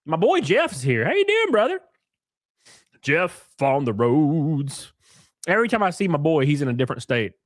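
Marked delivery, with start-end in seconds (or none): 1.0-1.8 high, bright hello to a buddy; excited greeting. Real, not acted. Streamer greeting his buddy Jeff with a high excited hello. 3.0-4.9 slow, stretched playful tag line; drawn-out sing-song. Real, not acted. Streamer teasing a traveling friend with a slow playful drawl.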